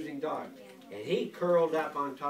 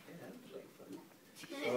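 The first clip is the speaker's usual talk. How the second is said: soft slow whisper; mouse whisper; 12 dB quieter, slower than usual. Acted. Telling child the mouse's thought, slow whisper. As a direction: whisper it slowly like a tiny mouse.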